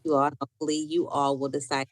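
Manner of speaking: ordinary talk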